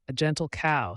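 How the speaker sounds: plain reading voice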